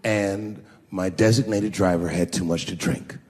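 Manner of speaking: put-on low, careful teenager voice; confessing son. Son asking dad for a ride, low confession. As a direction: imitate a son laying out bad news steadily.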